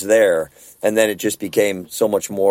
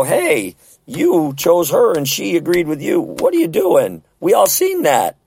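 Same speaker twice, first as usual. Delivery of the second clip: mock rebuke; 7 semitones higher, slower than usual. Confronting a newlywed who strays.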